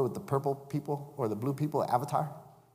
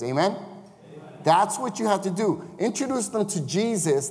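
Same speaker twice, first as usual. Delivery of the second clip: raised, insistent preacher voice; preaching at full force; 5 semitones higher, 5 dB louder than usual. Acted. Preacher urging the congregation to lead people to Christ. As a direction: drive the point home to the church.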